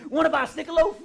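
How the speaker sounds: loud, high, laughing yell; blurted shout. Retold or imitated. Comedian blurting the wrong line at a stranger, loud shout. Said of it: "what my crazy brain kicked out".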